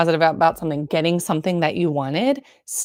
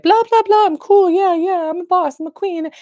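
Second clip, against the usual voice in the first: much higher, louder put-on voice of a fake-confident self; mock bravado; 13 semitones higher, 3 dB louder than usual. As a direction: brush it all off in a cartoonish "I'm fine, I'm a boss" voice. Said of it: "oftentimes I'll be like".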